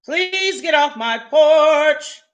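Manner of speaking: real annoyed call to someone outside; telling off. Real, not acted. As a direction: order them off the porch, slowly. Telling someone off her porch.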